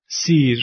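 سیر with a long "ee" vowel; long close "ee" in سیر. Shown as a demonstration. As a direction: say سیر with a drawn "ee", not "e". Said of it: "هم کشش داره".

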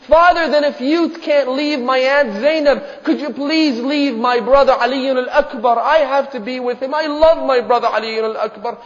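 impassioned acted plea; pleading daughter. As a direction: beg father as his little girl, preacher's acting. Daughter begging for her brother.

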